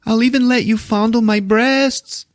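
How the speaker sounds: very high put-on plea, no real volume; mock yelling girlfriend. Pam begs Johnny to come back, mock yelling. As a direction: call him back in a squeaky imitated voice. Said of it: "Pam is yelling to Johnny".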